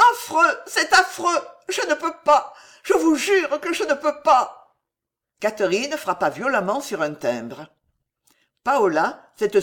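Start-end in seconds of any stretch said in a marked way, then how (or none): 0.1-4.7 high, loud, anguished cry; frantic high-pitched pleading; horrified refusal. A narrator's reading. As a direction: protest in desperation, voice far up. Alice refuses in horror.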